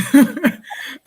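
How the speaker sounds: sudden high laugh breaking out; real burst of laughter. Real, not acted. Laughing out at her own blunt word to viewers. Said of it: "Uh, sorry".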